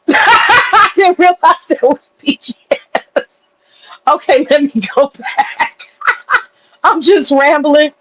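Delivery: embarrassed giggle at her mix-up; laughing at herself. Real, not acted. Realizing her mistake in front of chat, laughing at herself.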